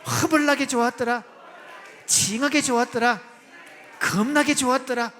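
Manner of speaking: loud Jeolla dialect cheers. Shown as a demonstration. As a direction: shout it out in a put-on Jeolla accent. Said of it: "전라도 버전 들어갑니다".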